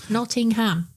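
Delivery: "Nottingham" with full "-ham" ending; proper Nottingham. Shown as a demonstration. Local teaching co-host, "Nottingham" with a clear "ham". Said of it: "Say it properly".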